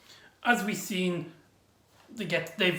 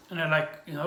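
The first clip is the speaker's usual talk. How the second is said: ordinary delivery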